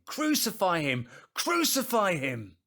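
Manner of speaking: repeated loud, high yells; insistent shouting. Acted. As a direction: keep yelling it at full force. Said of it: "but they kept shouting".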